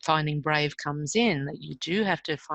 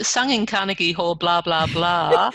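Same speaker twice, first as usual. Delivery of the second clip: louder mock repeat of her brag; teasing replay, getting louder; teasing echo; 6 dB louder than usual. Real, not acted. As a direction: throw her own words back at her, playfully. Excuse me.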